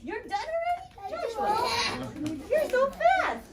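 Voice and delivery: high, delighted surprise; amazed at how fast he is; surprised praise. Real, not acted. Teacher surprised at fast child.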